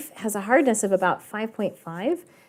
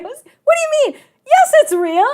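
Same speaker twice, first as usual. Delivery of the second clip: very high, mock-offended squawk; indignant disbelief; 6 dB louder than usual. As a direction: imitate a suitor aghast at being doubted. Acting offended suitor answering doubt.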